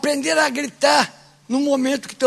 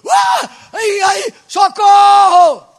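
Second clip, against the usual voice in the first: hammed-up high shriek for help, last word stretched; acted drowning panic; 9 semitones higher, 11 dB louder, much slower than usual. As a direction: flail and yell as if going under. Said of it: "depois se batendo". (grito encenado de quem se afoga)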